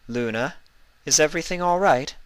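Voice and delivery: put-on high, quick, gentle older sister; concerned Celestia. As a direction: ask with a big sister's worry.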